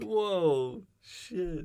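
a high, loud "whoa"; real shock. Real, not acted. Cohost hearing the story, a high loud gasp of disbelief.

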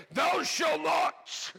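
huge, slow, high shout; hammed-up divine proclamation; booming voice of God. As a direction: thunder it out like a mock Almighty. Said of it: "for God to say".

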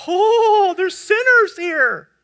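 loud, high, drawn-out mock gasp of surprise; hammed-up church-folk astonishment; mock shock. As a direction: act scandalized, stretching it out slowly. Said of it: "we get so surprised".

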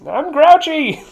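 high, loud cartoon grouch shout; hammed-up high-pitched grumpy puppet; Oscar the Grouch. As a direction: yell it as an improvised puppet grouch. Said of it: "what the fuck does Oscar the Grouch sound like".

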